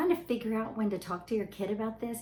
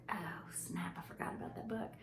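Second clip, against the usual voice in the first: hushed whisper to herself; sheepish whispered realization; guilty whisper; 11 dB quieter than usual. Retold or imitated. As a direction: whisper it as the penny drops. Mother realizes her mistake, aside.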